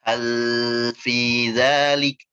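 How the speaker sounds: alsinatukum salsabila with the lam held far too long; wrong: lam stretched on and on; overlong lam. Shown as a demonstration. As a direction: drag out the l in salsabila, very slowly. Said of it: "Nah, dipanjangin".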